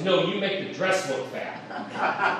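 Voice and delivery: slow, soft mock inner voice; drawn-out comic aside to oneself; private thought. Husband secretly answering his wife, under his breath. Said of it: "in the back of his mind".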